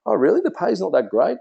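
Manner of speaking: high, bright feigned surprise; mock surprise. Shown as a demonstration. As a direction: act surprised to open the door. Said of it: "foot in the door for you".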